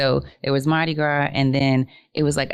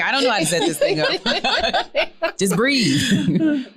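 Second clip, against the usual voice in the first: laughing husband; 8 semitones higher, much slower than usual. Retold or imitated. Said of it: "He's like".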